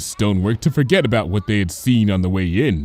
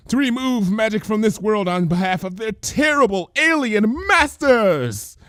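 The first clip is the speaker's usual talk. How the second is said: high, theatrical conspiracy voice; hammed-up grand declaration; dramatic cry; 10 semitones higher than usual. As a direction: proclaim it like a caped prophet. Lyra's long conspiracy lecture.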